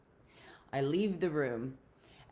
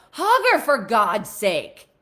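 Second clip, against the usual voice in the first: louder, slow scolding order; exasperated mom; 6 semitones higher, 15 dB louder, slower than usual. Retold or imitated. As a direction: push him like an impatient wife.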